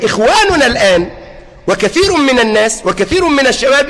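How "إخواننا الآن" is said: ordinary delivery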